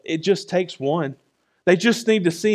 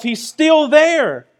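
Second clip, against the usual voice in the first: higher and slow, drawn out; high slow cry; 9 semitones higher, 4 dB louder, much slower than usual. Real, not acted. Preacher crying out to the congregation, high slow cry. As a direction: stretch it upward like a plea. Loud line before the whisper.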